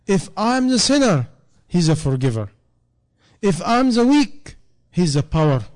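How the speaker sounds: fiery impassioned preaching; preaching at full force. Acted. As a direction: lift the crowd with each contrast. Climactic preaching passage.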